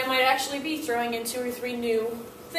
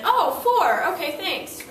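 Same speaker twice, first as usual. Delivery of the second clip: student's relieved thanks; 5 semitones higher, 5 dB louder, slower than usual. Retold or imitated. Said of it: "and then".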